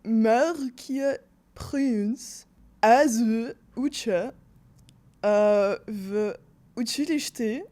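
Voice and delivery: slow, broken Bulgarian in a French accent. Shown as a demonstration. Guest disguises Bulgarian for the guessing host, halting French accent. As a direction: search for each word in a put-on French accent. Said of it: "I'll just stick to French".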